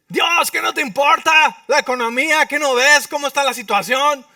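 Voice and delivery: loud, high mock prayer shouting at God; mock panicked prayer. Preacher mocking an anxious believer's prayer, hammed-up loud panic. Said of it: "Y tú dices".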